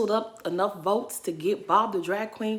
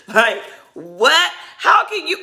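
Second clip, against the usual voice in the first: much higher, louder disbelief; shocked high outburst; incredulous outburst; 9 semitones higher, 8 dB louder, slower than usual. Real, not acted. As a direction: shriek in disbelief. Incredulous reaction to show.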